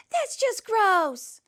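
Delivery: high, loud, slow cry of disgust; a girl shrieks in disgust at the giant's smell. Acted. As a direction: recoil at something gross.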